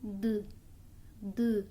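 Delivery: D with a trailing vowel; D said the wrong way, with a vowel after it; drawn-out D. Shown as a demonstration. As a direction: stretch the D into "dê". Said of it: "eu não posso dizer".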